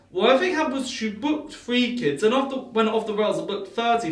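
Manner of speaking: usual speaking voice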